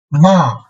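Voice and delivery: ma falling sharply from high; fourth tone. Shown as a demonstration. Teacher models ma for repetition, falling fourth tone. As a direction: start ma high and drop it suddenly. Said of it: "and fourth tone".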